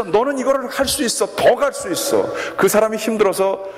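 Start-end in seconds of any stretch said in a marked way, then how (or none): 0.0-2.3 rising to a high, pushing call; coach's urging shout. Retold or imitated. Coach to blindfolded crawling student, high urging shout. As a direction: urge him on like a coach shouting. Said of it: "계속 소리를 치면서".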